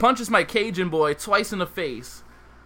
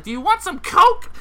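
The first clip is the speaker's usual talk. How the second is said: much higher, louder demanding offer; high, loud hooker voice; 14 semitones higher, 7 dB louder than usual. Retold or imitated. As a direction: pitch up and push it like a threat.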